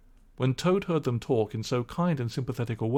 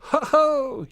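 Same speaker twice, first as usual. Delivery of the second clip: high, louder gleeful crowing; ecstatic crow; 6 dB louder than usual. Acted. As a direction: crow with delight. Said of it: "he cried, in ecstasies of self-admiration".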